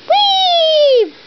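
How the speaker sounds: high, loud gleeful whee; playful launch whee; excited whee. Pretend. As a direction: squeal with delight as it flies. Toy bird launched from sling.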